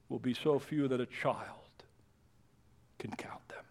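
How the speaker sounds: hushed whisper after the storm; whispered ending of the verse. Acted. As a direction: drop to a bare whisper. Sudden drop after the loud climax.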